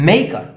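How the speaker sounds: Maker with a high, loud first syllable; first-syllable stress. Shown as a demonstration. Teacher modelling stress, first syllable of maker punched. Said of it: "first syllable ആണ് stress".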